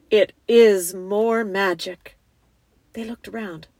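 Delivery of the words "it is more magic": loud, slow, majestic; great voice. A narrator's reading. Lion reveals himself behind the girls, great slow voice. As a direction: declare it slowly with grandeur. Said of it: "said a great voice".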